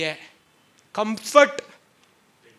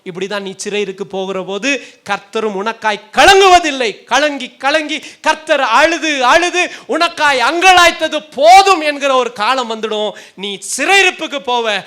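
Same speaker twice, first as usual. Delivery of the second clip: loud, raised prophetic warning; forceful preaching; 5 semitones higher, 10 dB louder than usual. Acted. Prophet warns the people of captivity, preaching forcefully.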